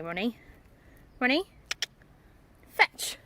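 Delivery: ordinary delivery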